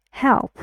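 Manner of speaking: "Help" pushed out firmly on its vowel; stressed single syllable. Shown as a demonstration. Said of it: "say this longer and louder".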